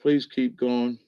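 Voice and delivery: normal talking voice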